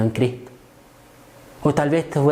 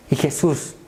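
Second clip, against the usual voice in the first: ordinary delivery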